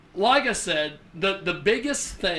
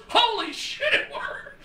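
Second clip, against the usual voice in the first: higher, laughing retold exclamation of amazement; buddy's amazed cry, high and laughing; amazed outburst; 14 semitones higher than usual. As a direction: blurt it out half laughing, stunned it worked. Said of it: "and he goes".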